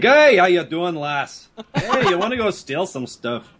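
hammed-up Scottish rogue; mock Scottish accent. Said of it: "the guy with the Scottish accent".

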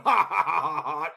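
gloating villain laugh; evil laugh. Acted. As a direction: cackle like a bad guy.

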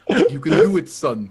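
hammed-up encouragement from a ghost; ghostly mentor. Ghost of Krakow urging on the sniper, hammed-up mentor voice. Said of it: "Krakow appears above me and puts his hand".

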